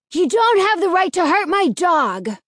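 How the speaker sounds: high, loud, defiant protest; insistent. A narrator's reading. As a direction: stand firm against him. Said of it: "the blond boy insisted".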